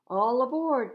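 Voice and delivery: high, loud all aboard call; conductor's cry. A narrator's reading. The conductor loudly calls passengers aboard the train. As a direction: call the platform to board. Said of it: "cried the conductor".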